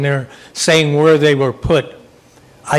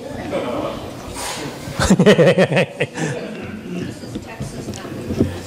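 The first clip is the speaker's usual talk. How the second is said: long chuckle trailing on; amused laugh; 3 semitones higher, 6 dB quieter than usual. Real, not acted. Moderator amused at everybody agreeing with him, long laugh. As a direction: laugh it off heartily, unhurried.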